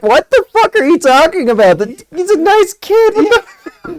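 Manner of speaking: incredulous outburst. Retold or imitated. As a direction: sound baffled that anyone would say that. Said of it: "I'm like".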